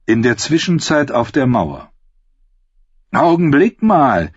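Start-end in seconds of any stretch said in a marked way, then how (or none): 3.1-4.3 louder, higher, slow protest; imagined reader objecting. Acted. Narrator voices a reader interrupting, as a mock protest. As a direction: stop the story with a mock objection. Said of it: "wird mancher sagen".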